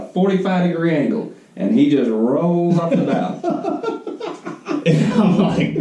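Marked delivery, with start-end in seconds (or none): 3.9-4.8 high breathless wheeze of a laugh; cracking up so hard no voice comes out; wheezing laugh. Real, not acted. Laughing helplessly while telling his co-host about the friend falling overboard.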